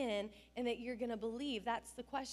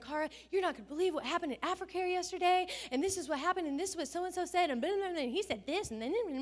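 excited gossip; 6 semitones higher, faster than usual. Retold or imitated. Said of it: "all the friends come running".

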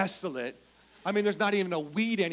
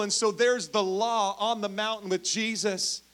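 ordinary talk, nothing marked

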